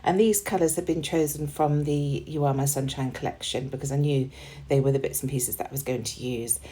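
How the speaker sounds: ordinary talk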